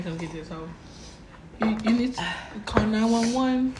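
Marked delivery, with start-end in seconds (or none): 1.6-3.7 mock panic. Mock emergency over choking friend.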